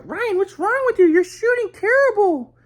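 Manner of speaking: very high-pitched mock mom scolding; mom yelling. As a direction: imitate a mother screeching criticism in a falsetto. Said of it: "my mom was, like, yelling at me".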